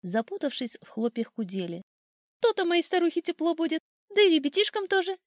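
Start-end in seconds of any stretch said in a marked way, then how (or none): 2.4-5.2 high, satisfied bird chatter; delighted old sparrow. A narrator's reading. Sparrow pleased for his family inside the birdhouse, high cheerful chirping. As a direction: muse aloud happily, pitched high. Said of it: "думал вслух старый воробей".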